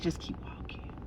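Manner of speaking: soft voiceless whisper in the ear. Retold or imitated. Boyfriend whispering to her outside the church, voiceless. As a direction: murmur it close so nobody else hears. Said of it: "he whispered in your ear".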